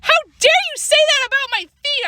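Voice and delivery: very high, indignant yell; outraged yell. A narrator's reading. Girl outraged at insult to her feet, yelling. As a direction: yell it high, deeply offended. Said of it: "I yelled".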